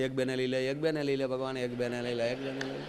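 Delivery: quiet, repeated pleading chant; mock soft prayer. Coffin seller praying at dawn, quietly pleading. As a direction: beg God softly, over and over.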